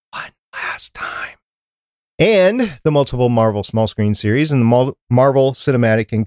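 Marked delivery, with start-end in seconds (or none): 0.1-1.4 slow, hushed whisper; whispered aside. Real, not acted. Host marks the final season in a slow whisper. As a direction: whisper it slowly, very softly.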